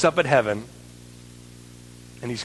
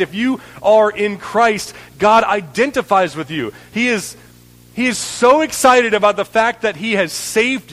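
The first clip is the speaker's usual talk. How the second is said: preaching; 6 semitones higher, 4 dB louder than usual. Real, not acted. Preaching at full force.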